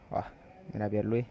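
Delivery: ordinary talk, nothing marked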